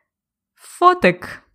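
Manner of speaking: teacher showing фотик in a playful high voice; playful slang. Shown as a demonstration. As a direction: lift фотик up high and stretch it. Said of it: "замість фотоапарат".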